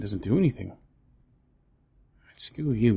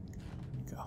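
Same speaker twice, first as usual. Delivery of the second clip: unvoiced whisper; 15 dB quieter than usual. Real, not acted. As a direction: whisper it so the monster won't hear. Sneaking quietly.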